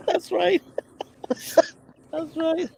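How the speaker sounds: high-pitched words through laughter; laughing agreement. Real, not acted. Host agreeing with a joke about useless schooling, laughing. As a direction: agree while cracking up.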